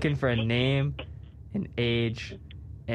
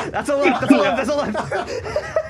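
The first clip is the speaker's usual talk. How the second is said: high, laughing call of the verdict, drawn out; 13 semitones higher, 6 dB louder, slower than usual. Real, not acted. Host laughs as he rules the flubbed line a left. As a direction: crack up while ruling it a left.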